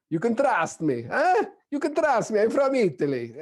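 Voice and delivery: high, hammed-up Italian accent. Shown as a demonstration. Speaker showing an audience the one that never works, Italian accent. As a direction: plead it in a comic, swooping Italian accent. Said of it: "You know exactly how it sounds".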